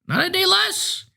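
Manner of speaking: possessed driver insists he is fine, thin and piping. A narrator's reading. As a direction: pipe it out like a frail eighty-year-old. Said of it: "in a thin, piping voice".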